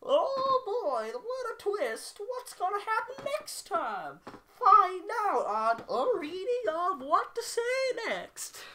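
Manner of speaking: very high, loud, slow cartoon host voice; mock cliffhanger announcer. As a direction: ham it up like a TV teaser. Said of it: "I should not. This is a serious part".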